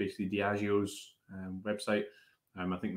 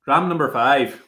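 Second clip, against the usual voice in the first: loud, higher-pitched Irish accent; 5 semitones higher, 11 dB louder than usual. Shown as a demonstration. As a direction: put on an Irish accent, half laughing. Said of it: "give us this Irish accent".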